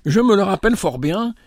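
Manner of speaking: high-pitched exclamation. A narrator's reading. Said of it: "s'écria Rose". (s'exclamer d'une voix haute)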